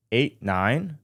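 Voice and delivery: normal talking voice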